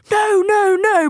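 high, loud frantic no; frantic shout. Retold or imitated. Mum shouts at the safari van to stay back, frantic high yell. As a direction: wave them off with a shrill yell.

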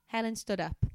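plain reading voice

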